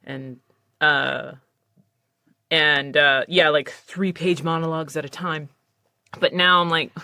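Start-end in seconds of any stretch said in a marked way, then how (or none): none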